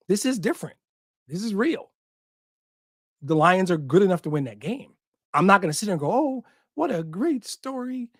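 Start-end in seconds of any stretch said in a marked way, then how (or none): 6.1-8.1 high, slow, syrupy fake gushing; drawn-out mock sentimental fan, high and slow; mocking sappy voice. Podcaster mocking a content fan, high slow gushing voice. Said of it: "I don't know why I'm doing that voice".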